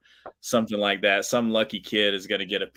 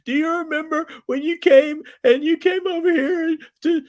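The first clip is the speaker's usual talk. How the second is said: very high, loud squealed whine; hammed-up tipsy weeping fan; mock drunken crying woman; 6 dB louder than usual. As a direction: imitate a sobbing drunk in falsetto. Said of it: "she was, like, crying".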